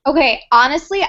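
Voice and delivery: ordinary talk, nothing marked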